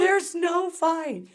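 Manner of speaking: high, slow mock-innocent voice; innocent denial. Retelling the shirt scramble, sweetly innocent denial.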